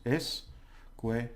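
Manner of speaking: escuela split into es, cue, la with gaps; syllable by syllable. Shown as a demonstration. Teacher breaking the word down for learners, syllable by syllable. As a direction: separate each syllable of escuela slowly. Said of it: "break them down into syllables".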